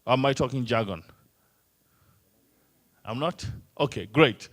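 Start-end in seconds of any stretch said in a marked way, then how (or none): none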